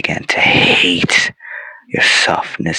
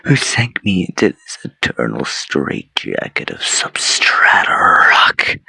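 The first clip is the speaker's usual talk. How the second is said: normal talking voice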